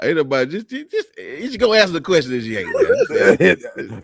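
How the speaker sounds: talking through laughter; laughing. Real, not acted.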